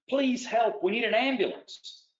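high, urgent cry for help through the door; desperate plea for help. A narrator's reading. Comer begs at the door, urgent high plea.

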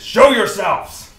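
loud, high, drawn-out challenge; in-character scream. Acted. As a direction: bellow a demand into the darkness. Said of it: "he screams into the darkness".

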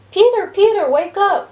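high, loud call to wake someone; urgent cry. A narrator's reading. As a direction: cry out in alarm, pitched well up. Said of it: "cried Judy".

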